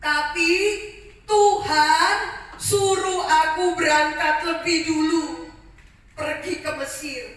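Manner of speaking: loud and high on "Tuhan", slowing after; loud insistence. Acted. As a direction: proclaim it firmly. Joseph insists on God's plan.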